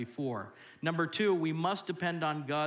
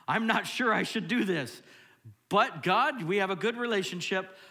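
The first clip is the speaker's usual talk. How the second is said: high, loud pleading with God; acted protest; 8 semitones higher, 8 dB louder than usual. Believer unsure about a word from God, loud acted protest. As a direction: argue upward, hammed-up reluctance.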